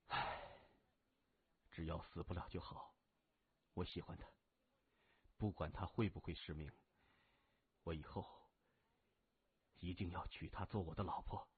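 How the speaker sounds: low, soft, with a heavy sigh; downcast. A narrator's reading. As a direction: vow it quietly and sadly, with long pauses. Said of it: "黯然地说". (黯然低语)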